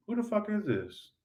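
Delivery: put-on a hushed jealous girlfriend line; quoted girlfriend. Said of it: "Some of you".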